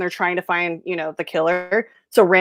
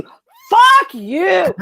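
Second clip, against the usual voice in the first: high, loud exploding fuck-you; Randall; 11 semitones higher, 7 dB louder than usual. Acted. Movie fan quoting Randall after the fight, furious shout. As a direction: blow up on the second one, much higher. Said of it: "the Randall double fuck you".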